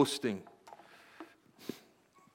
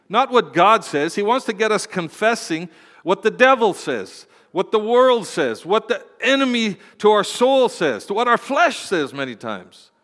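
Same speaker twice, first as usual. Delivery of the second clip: higher, louder pulpit delivery; impassioned preaching; 5 semitones higher, 6 dB louder than usual. Real, not acted. Preacher warning church about the devil, forceful preaching. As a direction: build the warning with mounting force.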